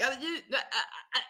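quiet, flustered mock stammer; lost for words. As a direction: imitate someone fumbling for an answer. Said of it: "He had no idea what to say".

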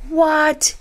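high, drawn-out disbelieving what; incredulous. Retold or imitated. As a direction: squeal the question in disbelief. Incredulous reaction to her own belief.

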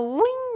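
high springy woing; a cartoon jump noise; boing sound effect. Jumping up to the stars.

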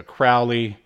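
normal talking voice

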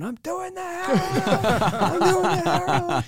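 very high, drawn-out mock scream of a little boy; imitated child screaming. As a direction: mimic a runaway toddler yelling, stretching it out the second time. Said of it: "and he's screaming".

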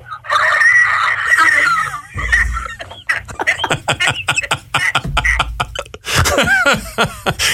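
wheezing smoker's laugh like a cough; wheezy cough-laugh. Shown as a demonstration. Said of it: "I'll do it now".

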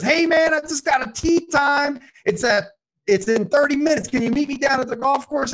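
high, loud, eager buddy; buddy on the phone. Retold or imitated. Buddy calls husband about tee time, high and eager. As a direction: pitch the tee time with pumped-up energy. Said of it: "And his buddy goes".

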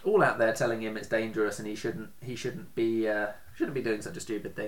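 normal talking voice

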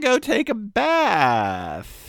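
put-on high, slow, whining like a kid; whiny child voice. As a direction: drag it out like a sulky child. Spouse whining about chores.

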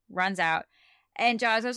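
ordinary talk, nothing marked

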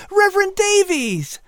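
put-on high boy's voice, glad greeting; a child delighted at a visitor; happy Patrick. A narrator's reading.

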